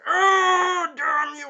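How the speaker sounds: huge high anguished roar; hammed-up screaming rage; shield rage. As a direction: roar a curse in imitated fury. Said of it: "taking out his shield rage".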